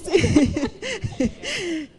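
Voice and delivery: high falling laugh. Real, not acted. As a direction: laugh at the memory. Ríe por la propuesta de matrimonio. (risa aguda que cae)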